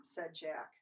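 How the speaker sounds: usual speaking voice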